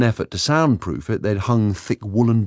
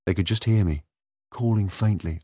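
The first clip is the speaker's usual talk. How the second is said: usual speaking voice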